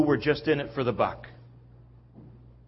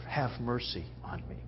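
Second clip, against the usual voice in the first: very soft, low plea; humble quiet plea; 4 semitones lower, 12 dB quieter than usual. Acted. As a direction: let the plea sink almost to nothing. Quotes beggar's humble plea for mercy.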